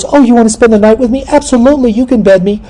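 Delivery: high falsetto, over-eager consent; mock gullible woman. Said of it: "And she goes".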